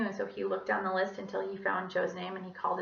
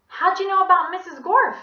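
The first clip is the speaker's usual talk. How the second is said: put-on high, loud question in a light French accent; 10 semitones higher, 11 dB louder than usual. A narrator's reading. Calvin questioning a classmate about Missus Gorf, French accent. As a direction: ask it with a hint of French accent. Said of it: "asked Calvin in a French accent".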